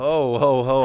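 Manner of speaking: loud jolly ho ho ho; booming laugh like Santa. Real, not acted.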